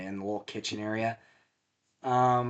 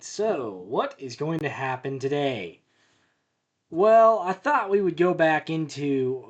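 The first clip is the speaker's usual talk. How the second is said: YouTuber intro; 3 semitones higher, 5 dB louder than usual. Acted. Channel intro to viewers.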